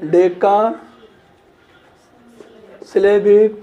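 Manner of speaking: each word set apart with long pauses; slow, spaced-out dictation of a definition. Shown as a demonstration. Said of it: "dictating the definition slowly".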